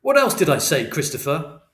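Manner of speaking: loud, pressing question; angry demand. A narrator's reading. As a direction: demand an answer furiously. Father interrogating angrily.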